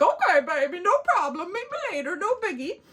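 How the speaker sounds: high-pitched, breezy mock pickup artist; British guy brushing it off. British guy backs off after failed kiss, mock breezy. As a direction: imitate a rejected man playing it cool, voice climbing. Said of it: "And he's like".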